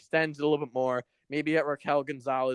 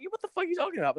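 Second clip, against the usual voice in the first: incredulous outburst; much faster than usual. Retold or imitated. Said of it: "I'm like".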